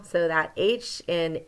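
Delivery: ordinary talk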